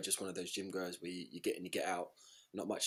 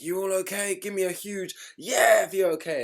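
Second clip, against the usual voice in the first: screaming instructor; 11 semitones higher, 9 dB louder than usual. Acted. Instructor hollering at an online class member, screaming. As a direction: yell it like a hyped class instructor. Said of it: "screaming at him like".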